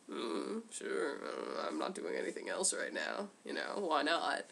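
low, soft, offhand mumble; nonchalant Machete. Retold or imitated. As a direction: shrug it off, unbothered. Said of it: "then he's like".

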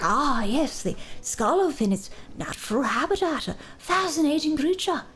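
lightly voiced nature documentary narrator; hint of a hushed wildlife narrator; David Attenborough voice. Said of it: "having David Attenborough's voice".

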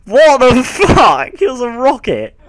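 loud, high, slow disbelief; shocked yell. Real, not acted. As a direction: cry out in shock. Horse outruns them.

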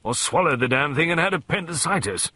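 high, blustering complaint; disgruntled old colonel; indignant grumbling. A narrator's reading. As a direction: keep grumbling, working up the outrage. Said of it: "he grumbled".

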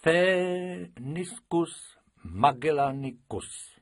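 very slowly, spelling it out; a pupil reading a hard Latin name. Acted. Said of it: "Krupičková pomalu četla". (pomalu, slabiku po slabice)

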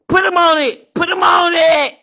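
high, loud repeated order; yelling. Acted. Yelling at Madame Lo to put Breon on it. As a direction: bark the order again and again.

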